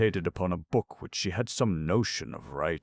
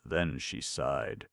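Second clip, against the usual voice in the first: usual speaking voice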